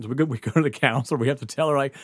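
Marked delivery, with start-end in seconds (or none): none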